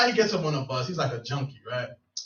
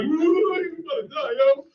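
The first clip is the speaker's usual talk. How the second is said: mock jeer; 9 semitones higher than usual. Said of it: "I'm just an ass".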